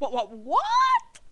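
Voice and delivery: slow build to a loud high shriek; mother's mock shock. Mother hearing her son's diagnosis, loud exaggerated shock. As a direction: stammer up into a hammed-up cry of disbelief. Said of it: "She's like".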